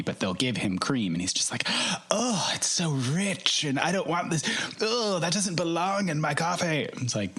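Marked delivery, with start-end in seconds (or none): 2.1-6.8 higher, mock-fussy English husband; husband impression. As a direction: pout it out like a put-out husband. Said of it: "I really like your impression of your husband".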